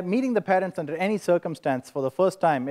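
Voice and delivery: normal talking voice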